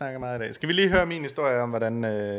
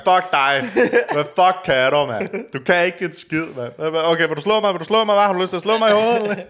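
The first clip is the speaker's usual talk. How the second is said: higher, louder hammed-up provocation; mock tough guy; 4 semitones higher, 6 dB louder than usual. Friend teasing how he picked the fight, mock taunting. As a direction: taunt him like a bar brawler, half laughing. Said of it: "og så du går op og sagt".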